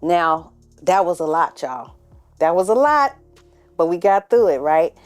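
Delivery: pumped host coming back from a break; show host intro. Acted. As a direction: kick the episode back off with energy. Loud show intro after the break.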